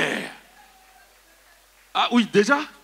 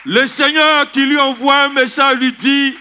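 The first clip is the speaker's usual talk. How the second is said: raised, louder preacher's voice; preaching proclamation; 7 semitones higher, 5 dB louder than usual. Acted. Preacher tells congregation of the intensive-care miracle, proclaiming. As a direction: proclaim it high and strong to the church.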